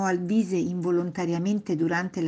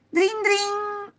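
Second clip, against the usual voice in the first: high ringing drin-drin; doorbell imitation. Boy ringing grandparents' bell, high imitated ring. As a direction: trill it like a doorbell. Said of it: "suonando il campanello".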